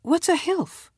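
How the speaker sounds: high-pitched, puzzled question; woman's dialogue. A narrator's reading. Woman asking in narrated dialogue.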